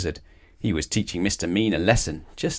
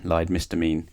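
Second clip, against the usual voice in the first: plain reading voice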